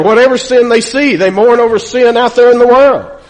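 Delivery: preacher lifting the congregation, voice rising; preaching at full force. Acted. Pastor telling the congregation who mourns over sin, preaching at full force.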